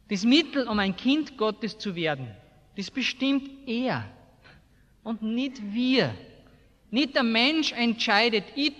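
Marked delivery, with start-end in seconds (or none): none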